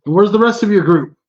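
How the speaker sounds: high, loud goblin voice; goblin calling out. Acted. Goblin on the roof questioning the lone adventurer, high loud. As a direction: demand it from the rooftop. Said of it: "He calls out".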